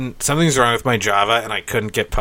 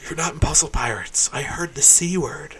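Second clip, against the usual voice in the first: unvoiced hushed whisper; 4 semitones higher, 7 dB quieter than usual. Friend whispering a warning about the forbidden word. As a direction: whisper it like a scandal. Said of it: "And then you'd be like".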